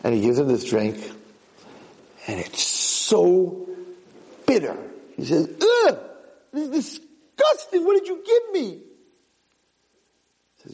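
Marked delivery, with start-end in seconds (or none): 2.4-4.8 "so" stretched long and high; storyteller's emphatic "so", slowed right down; drawn-out emphasis. A narrator's reading. Narrator dwells on bitter drink. 5.5-8.7 very high revolted outburst; hammed-up disgust. Boy tastes bitter drink.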